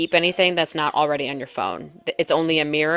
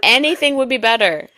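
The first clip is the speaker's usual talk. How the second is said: usual speaking voice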